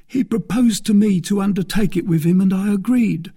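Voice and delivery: high-pitched anxious captive; confessing bandit. A narrator's reading. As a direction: admit it in a high, pleading voice. Bandit admits the plot.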